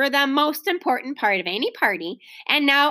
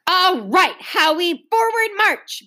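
raised, forceful drill command; ordering; 7 semitones higher, 4 dB louder, slower than usual. A narrator's reading. Big sister tries distracting everyone, ordering. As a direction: call it out like a parade leader. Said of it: "Beezus ordered".

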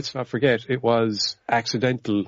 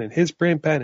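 usual speaking voice

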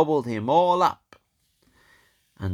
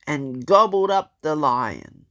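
ordinary delivery

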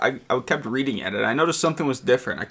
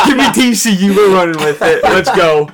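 loud, excited hype, much higher and louder; hyped pick; 4 semitones higher, 7 dB louder, slower than usual. Real, not acted. Hyped prediction.

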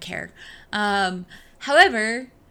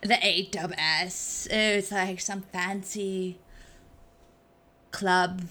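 slow, drawn-out mock snob voice; fancy club snob; 2 semitones lower, 4 dB quieter, slower than usual. Co-host joking about a fancy AWS club, mock snobbery. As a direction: put on airs as if at an exclusive club. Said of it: "if we kept talking like this".

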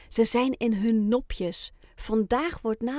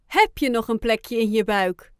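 put-on louder, playful boy's question; teasing Amir; 6 dB louder than usual. A narrator's reading. Amir teases Oscar about his full belly, playful and loud. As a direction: tease the hungry penguin. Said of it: "vraagt Amir".

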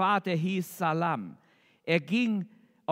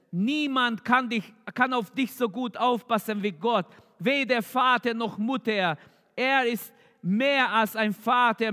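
raised, urgent pulpit voice, pitch lifted; fervent preaching; 4 semitones higher than usual. Acted. Preacher calling out to the sick congregation, raised fervent preaching. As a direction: preach it out to the congregation with growing fervour. Preacher builds to full force.

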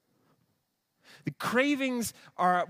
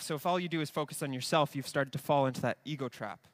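ordinary talk

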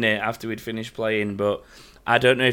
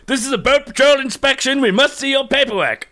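much higher, louder official demand; bossy, laughing inspector act; mock inspection officer; 11 semitones higher, 4 dB louder than usual. Boarding galleons as fake patrol, in a laughing officious voice.

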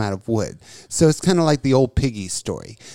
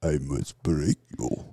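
much lower, much softer Russian menace; Ivan Drago impression; 11 semitones lower, 11 dB quieter than usual. Drago impression for a friend, low Russian menace. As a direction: growl it like Drago in the ring. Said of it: "You're not Ivan Drago".

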